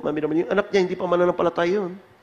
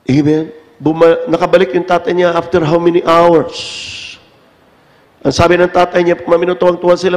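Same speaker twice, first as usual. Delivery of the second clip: loud, raised fervent testimony; preaching at full force; 2 semitones higher, 10 dB louder than usual. Acted. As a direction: preach it to the whole congregation.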